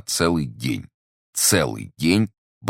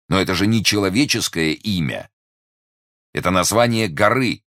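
exasperated, higher and emphatic; flustered exclamation; 6 semitones higher, 5 dB louder than usual. A narrator's reading. Investigator baffled by the musketeer's name, losing his head. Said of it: "бедный следователь, начинавший терять голову".

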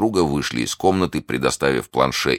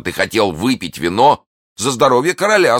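higher-pitched scolding question; indignant cry; 9 semitones higher, 4 dB louder than usual. A narrator's reading. D'Artagnan to Planchet about drinking the wine, indignant. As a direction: confront the servant, indignant. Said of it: "вскричал Д'Артаньян".

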